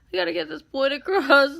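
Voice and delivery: high, loud, giggly repeat; close to laughing. Real, not acted. Makeup artist repeating her joke mid-liner, about to crack up. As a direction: push it out on the edge of laughter. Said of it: "I'm gonna start laughing".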